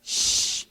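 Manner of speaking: long whispered shush. Acted. Crowd silencing the blind beggar, drawn-out shush. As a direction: hush someone with a long shh.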